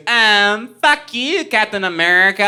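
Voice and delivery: high, loud insult in a lady's voice; hammed-up drunk woman yelling; mock yelling lady. Lady yelling in parking lot.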